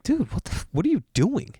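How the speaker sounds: high, hushed disbelief; soft, high-pitched "what are you doing"; incredulous friend. Retold or imitated. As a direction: pitch it way up, appalled at your buddy. Friend appalled at his rudeness.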